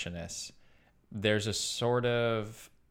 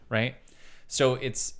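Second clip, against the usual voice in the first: ordinary talk, nothing marked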